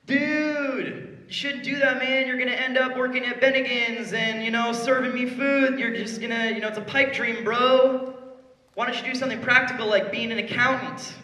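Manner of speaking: a lightly hammed-up doubting buddy; mocking naysayer bro. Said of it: "And people are gonna tell you".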